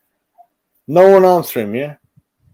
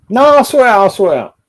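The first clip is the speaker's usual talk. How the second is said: higher and louder, shouting that he'll sort it; loud boast; 9 semitones higher, 9 dB louder, faster than usual. Acted. Streamer insisting he'll handle it, loud boasting shout. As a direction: bellow it out, full of himself.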